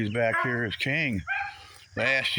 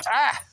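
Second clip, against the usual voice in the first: sudden high louder ouch cry; sharp pained yelp; 10 semitones higher, 4 dB louder than usual. Real, not acted. Man hurt by his dog, sharp yelp. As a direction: yelp as the dog catches you.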